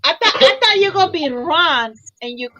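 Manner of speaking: normal talking voice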